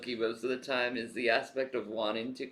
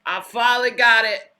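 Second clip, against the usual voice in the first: put-on southern accent; 9 semitones higher, 14 dB louder than usual. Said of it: "putting a southern accent on him".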